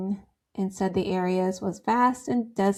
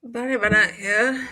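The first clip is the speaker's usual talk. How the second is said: reporter voice; 3 semitones higher than usual. Acted. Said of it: "It sounds like a reporter".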